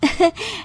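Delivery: soft, high giggle; pleased giggle. Acted. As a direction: giggle lightly in delight. Daughter-in-law giggles at the offer. (हल्की खिलखिलाहट)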